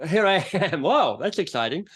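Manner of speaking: mock wide-eyed excitement; imitated pleasant surprise. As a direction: act swept up and amazed. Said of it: "you're kind of".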